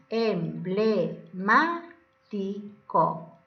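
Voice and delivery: "Emblemático" very slowly, em, ble, má, ti, co; syllable by syllable. Shown as a demonstration. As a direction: pause after each syllable of "Emblemático". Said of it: "Primero silabeamos". ("Emblemático" silabeado lentamente: em, ble, má, ti, co)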